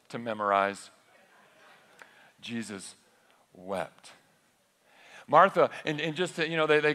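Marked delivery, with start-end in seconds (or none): 2.4-4.1 low, soft, with a long pause before wept; solemn pause. Acted. Preacher pausing over Jesus wept. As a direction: let the silence hang before wept. Famous verse delivered with dramatic pause.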